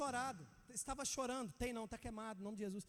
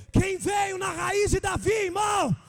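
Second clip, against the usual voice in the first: higher, louder shouted repeat, slow; shouted question; 8 semitones higher, 14 dB louder, slower than usual. Real, not acted. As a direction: climb into a full preaching shout. Preacher repeating question, climbing to shout.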